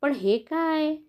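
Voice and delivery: ordinary delivery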